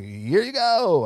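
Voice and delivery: slow, high and loud, breezily handing it over; mock carefree handout. To a caller wanting money, a hammed-up slow carefree giveaway. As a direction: drag it out like cash is no object. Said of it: "and I'm like".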